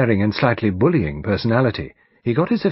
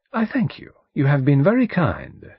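higher-pitched, polite detective voice; courteous detective; 7 semitones higher, 3 dB louder than usual. Acted. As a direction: thank him with formal courtesy.

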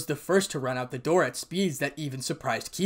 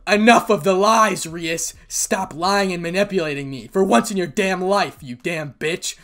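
loud, furious accusation; angry outburst; 4 semitones higher, 8 dB louder than usual. Acted. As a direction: cut her excuses off in anger. Issei rages at Rias for lying.

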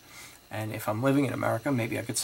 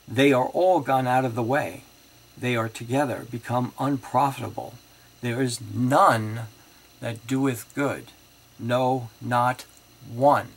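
emphatic scripture reading; 5 dB louder than usual. Acted. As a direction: preach the verse, building to "one". Reads scripture aloud emphatically.

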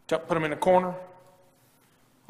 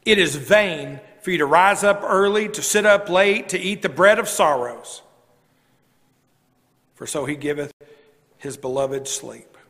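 scripture reading; 2 semitones lower than usual. Acted.

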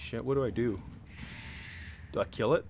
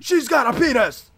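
shouting recruit; 11 semitones higher, 7 dB louder than usual. Pretend. Recruit answering drill sergeant.

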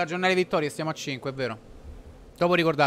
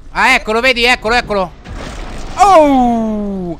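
excited shout; 5 semitones higher, 12 dB louder than usual. Real, not acted. Spots the lightning strike.

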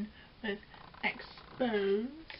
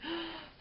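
mock gasp; 7 semitones higher, 6 dB quieter than usual. Mock shock at lipstick patch.

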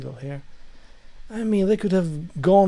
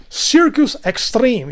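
announcer voice; 6 semitones higher, 6 dB louder, slower than usual. Retold or imitated. Announcing circus name.

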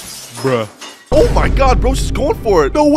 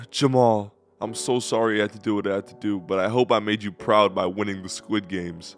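sad quiet; 8 semitones lower, 8 dB quieter than usual. Acted. Mourning dead friend.

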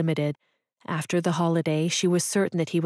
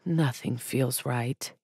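slow, soft, sighed-out line, lower; sighing, defeated; 5 semitones lower, 4 dB quieter, slower than usual. A narrator's reading. Alone on the couch, Stuart admits defeat, sighing softly. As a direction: let it out on a weary sigh. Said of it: "He sighed".

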